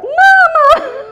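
high, loud terrified cry for mama; scared kids crying. Retold or imitated. Little kids calling their mother in fright, screaming high. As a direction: shriek for mama in a panic. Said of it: "all the little kids were like".

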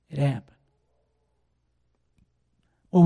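normal talking voice

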